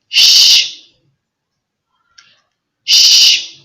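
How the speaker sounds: long voiceless "sh" hiss; sh sound. Shown as a demonstration. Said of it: "the next sound is".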